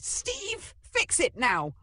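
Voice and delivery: high, urgent shouted order; shouting. A narrator's reading. Girl to her magician pet, shouting. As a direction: yell the order in exasperation. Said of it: "Abigail shouted".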